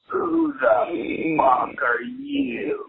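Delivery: very slow, high, drawn-out mock stranger; toothless stranger. Toothless man confronting a waking houseguest, high drawn-out mock voice. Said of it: "toothless young man ... And he goes".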